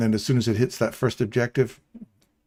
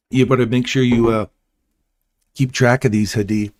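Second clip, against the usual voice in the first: 1 loud ribbing; 8 dB louder than usual. Real, not acted. Teasing friends about owing coffee.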